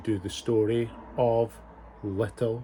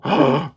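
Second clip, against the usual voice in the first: dramatic gasp; 5 dB louder than usual. Acted. Narrator gasps at wolf's plan.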